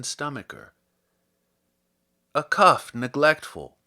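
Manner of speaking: measured, lyrical poetry reading; poem reading. Acted. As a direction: read it like verse for a listener. Reads a Herrick poem aloud.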